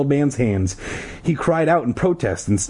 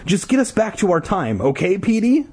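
put-on high, pressing girl voice; urgent girl; 10 semitones higher than usual. A narrator's reading. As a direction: urge him on. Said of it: "Ashley said".

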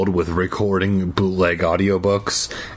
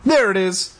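high thrilled shout; excited shout; 10 semitones higher than usual. Acted. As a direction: shout it out in discovery. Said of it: "Daniel excitedly shouted".

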